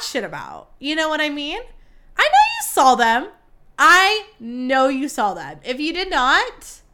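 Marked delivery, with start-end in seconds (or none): 2.2-3.3 high, loud accusing insistence; insistent. Real, not acted. Gossip host to listeners about premiere photos, insistent. 3.8-5.6 slow, loud, drawn-out emphasis; emphatic repeat. Real, not acted. Gossip host to listeners about premiere photos, slow and emphatic.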